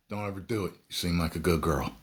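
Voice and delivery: usual speaking voice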